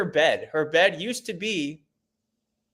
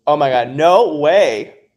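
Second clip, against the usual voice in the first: amazed exclamation; 9 dB louder, slower than usual. Real, not acted. Reacting to a viewer's pet list.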